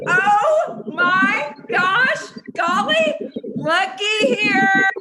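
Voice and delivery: slow, high, loud drawn-out gasp; overjoyed at the winner's name; excited. Real, not acted. Excited drawing reaction.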